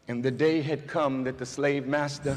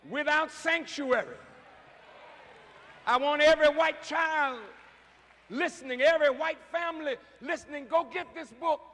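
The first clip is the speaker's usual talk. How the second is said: fiery preaching; 9 semitones higher than usual. Acted. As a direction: preach it hard, climbing toward a cry. Preacher urging the audience.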